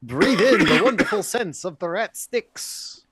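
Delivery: high, loud tour-guide patter; mock-grand welcome. Local guide welcomes visitors to the city, mock-grand. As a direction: proudly present a dubious attraction, amused.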